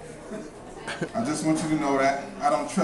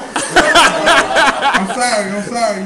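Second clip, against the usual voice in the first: laughing apology; 7 semitones higher, 10 dB louder, much slower than usual. Retold or imitated. Apologizing to kid, retold.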